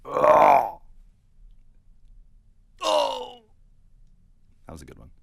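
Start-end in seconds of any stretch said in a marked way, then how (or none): 0.1-3.4 high loud dying moan; dying gasp. Pretend. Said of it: "two more dying".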